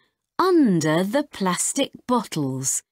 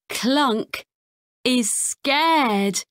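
ordinary delivery, nothing marked

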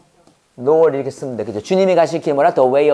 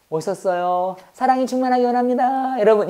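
gentle, polite preacher; 4 semitones higher, 3 dB quieter than usual. Acted. Teacher mimics a polite preacher to contrast with crying out, gentle mock preaching. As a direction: preach politely and softly, holding an even singsong tone. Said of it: "인격적으로 설교해?".

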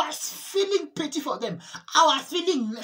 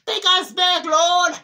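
loud, high cry to be taken back; a tormented soul crying out loud to God; desperate shout; 6 semitones higher, 8 dB louder, much slower than usual. Retold or imitated. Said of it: "and they shouted".

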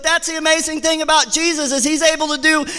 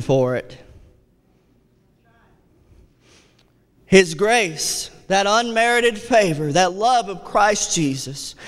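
tender, low preaching; 7 semitones lower than usual. Acted.

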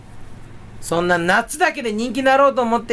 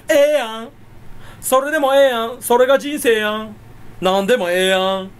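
annoying Kansai dialect; 2 semitones higher than usual. Acted. Streamer cuts the topic short, annoying fake Kansai dialect. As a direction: lay on an irritating mock Kansai lilt. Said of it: "世界一ムカつく関西弁でしたね".

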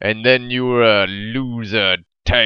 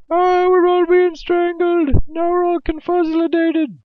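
much higher, cartoon squeak; mock squeaky voice. Voicing the party caught by the boss, squeaky mock voice. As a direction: squeak it like a panicked little cartoon. Mocking party getting strangled.

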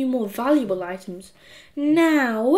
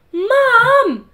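high, loud scream for mum; boy screaming for his mother; 11 semitones higher, 8 dB louder than usual. Acted. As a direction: yell it up the stairs at mum. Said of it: "screamed Henry".

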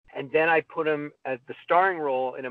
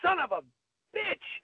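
mock yelp of pain, much higher; a touch of hammed-up pain; retold pained curse. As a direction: squeal the curse as if hurt. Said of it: "I remember her going".

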